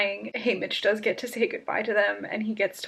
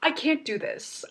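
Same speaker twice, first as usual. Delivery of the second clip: normal talking voice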